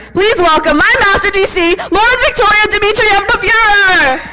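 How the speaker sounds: high, slow announcer build-up; grand announcer intro. Real, not acted. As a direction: announce her like a champion. Grand introduction of final delegate.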